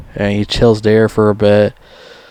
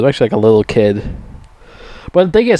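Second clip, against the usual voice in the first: usual speaking voice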